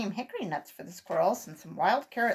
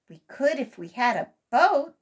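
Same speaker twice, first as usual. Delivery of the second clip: brave suggestion; 6 semitones higher, 8 dB louder than usual. A narrator's reading. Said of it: "said Sammy bravely".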